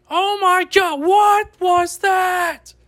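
shocked high cry of disbelief; stunned squeal, drawn out; high surprised gasp. Real, not acted. Opening exclaim, very high.

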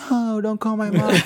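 high-pitched whiny begging, retold; mock crying plea. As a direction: plead like a tearful little kid, half joking. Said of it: "I start crying like a motherfucker".

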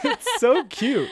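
high squealing laugh through words. Real, not acted. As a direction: laugh while gushing. Laughing after imitation.

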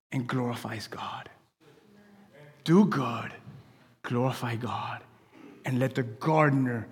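soft, low whisper. Acted. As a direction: drop to a hushed whisper. Preacher drops to a whisper.

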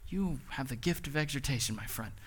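soft deadpan, fading to almost nothing; mock compliment, underplayed; sarcastic aside to an old friend; dry sarcasm. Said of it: "I was like".

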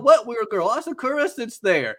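high, mock-baffled pushback; high-pitched retold disbelief; acted incredulity. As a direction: imitate classmates flatly contradicting him. Classmates contradict Kyon, acted.

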